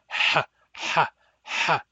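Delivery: loud raspy ha from the throat; noisy pharyngeal ha; back H hissing. Shown as a demonstration. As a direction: hiss the ha through a tight throat. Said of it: "a loud hissing through the pharynx".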